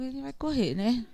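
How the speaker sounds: normal talking voice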